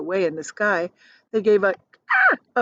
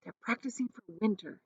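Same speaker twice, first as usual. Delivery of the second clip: soft; 11 dB quieter, faster than usual. A narrator's reading. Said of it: "James said".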